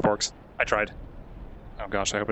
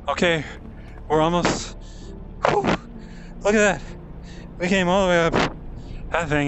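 out of breath; 6 semitones higher than usual. Real, not acted. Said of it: "I'm out of breath".

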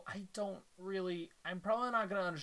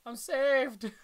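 relieved laugh; 8 semitones higher, 7 dB louder than usual. Acted. Relieved character.

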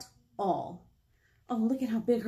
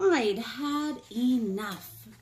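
ordinary delivery, nothing marked

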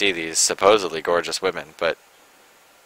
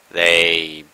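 ordinary delivery

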